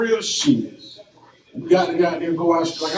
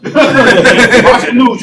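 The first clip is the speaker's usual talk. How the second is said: louder, higher, slowly drawn out through laughter; laughing repeat; 6 semitones higher, 9 dB louder, much slower than usual. Shown as a demonstration. Repeating it to a friend, laughing loudly. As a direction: stretch the words out while cracking up.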